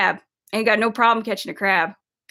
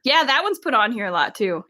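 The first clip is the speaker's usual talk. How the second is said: ordinary talk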